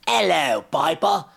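loud, high English greeting; hammed-up English hello; English accent. Shown as a demonstration. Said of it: "in an English voice".

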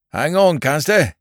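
put-on loud old country dialect call; rustic farmer calling.

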